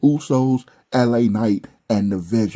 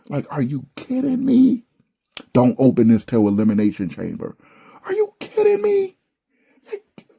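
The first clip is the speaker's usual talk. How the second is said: incredulous squeal; 5 semitones higher than usual. Real, not acted. Reviewer cries out at the crate gimmick, incredulous squeal. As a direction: voice shooting up high in disbelief.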